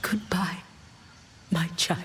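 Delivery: a breath of a goodbye; whispered goodbye. Acted. Final goodbye before vanishing.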